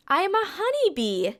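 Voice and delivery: high, bright-loud announcement of the answer; spring the answer like a surprise; excited reveal. Pretend. Teacher revealing the answer to children, excited and high.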